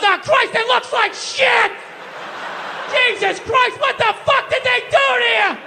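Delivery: mock outraged father shouting; blunt dad. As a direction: blurt it like a tactless dad. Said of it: "He just goes".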